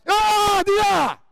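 high loud yell of di là; sudden yell. Real, not acted. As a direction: shout to send him over. Coach yells direction mid-play. (grido improvviso)

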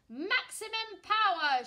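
loud commanding shout, slow. Acted. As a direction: bellow the order to full power. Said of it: "shouts Commander Mandy".

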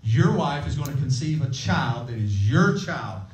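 usual speaking voice

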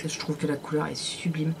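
soft whispered admiration, a little secret; whispered aside. Real, not acted. Whispering to viewers about the lipstick colour. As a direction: breathe it out as a hushed aside.

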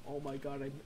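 ordinary talk

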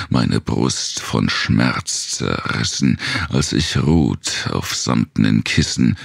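ordinary delivery

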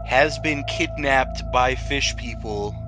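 ordinary talk, nothing marked

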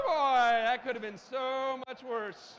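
loud, slow, drawn-out relief; big relief. Real, not acted. Speaker after presentation nearly failed to load, big relief. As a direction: stretch it out with huge relief.

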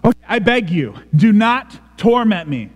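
high, forceful plea for mercy; tormented begging. Acted. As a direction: beg as if in torment, punching each word. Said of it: "Sounds tormented".

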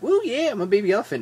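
put-on high, fast, playful woo of a baby elephant; mock excited baby elephant; mock baby elephant cheer. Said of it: "And he's like".

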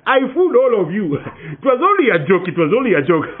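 high, loud gloating through laughter; taunting. Acted. Boy gloating at the villagers he fooled, laughing. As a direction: rub it in, laughing.

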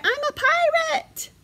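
very high, gleeful little bear; crying out. A narrator's reading. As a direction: boast like a child at play. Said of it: "cried Baby Bear".

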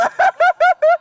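high burst of laughter. Real, not acted. Laughing at joke.

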